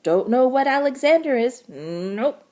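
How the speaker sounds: a blunt frog barking out his answer; croaking frog. A narrator's reading. Frog telling Jessie he doesn't know, loud and blunt. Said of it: "croaked the frog".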